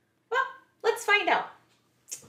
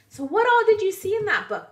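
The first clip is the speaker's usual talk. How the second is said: plain reading voice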